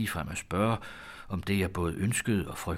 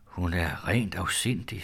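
ordinary delivery

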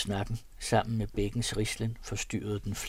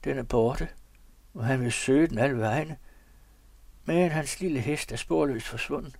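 strange, higher-pitched storytelling; peculiar voice; 5 semitones higher, 4 dB louder than usual. A narrator's reading. Tells his companion of a vanished horse, peculiar voice. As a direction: tell it oddly, pitch lifted. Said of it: "med en besynderlig stemme".

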